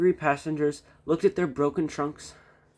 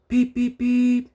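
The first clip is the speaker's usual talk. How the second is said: high, loud beeping call; whistle call; 12 semitones higher, 7 dB louder, slower than usual. A narrator's reading. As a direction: toot it out. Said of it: "he called".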